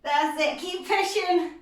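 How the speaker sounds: ordinary talk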